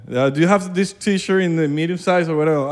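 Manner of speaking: put-on English with a Venezuelan accent, drawn out. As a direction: imitate halting shop English in a Venezuelan accent. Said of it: "Te escuchan con ese acento".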